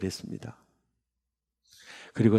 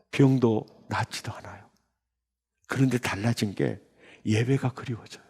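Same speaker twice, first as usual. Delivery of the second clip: ordinary delivery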